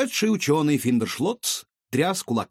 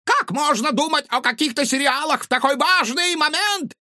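high, loud indignant shriek; furious, disbelieving scientist; outraged mad scientist; 4 dB louder than usual. A narrator's reading. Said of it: "разозлился доктор".

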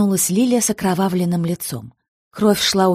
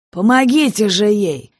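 loud sharp order; commanding; 4 semitones higher, 5 dB louder than usual. Acted. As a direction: bark the order to the room. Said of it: "приказал".

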